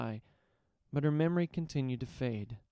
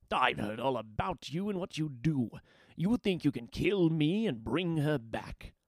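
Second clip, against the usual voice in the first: contemptuous brute, challenging; sneering warlord; 3 semitones higher than usual. Acted. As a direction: taunt him with scorn.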